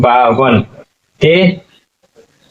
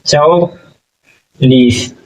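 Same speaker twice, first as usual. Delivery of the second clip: slowly stretched चौलीस; drawn-out number; much slower than usual. Shown as a demonstration. Teacher drawing out चौलीस for the class, very slow. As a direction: drag out चौलीस syllable by syllable. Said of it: "चौवालीस".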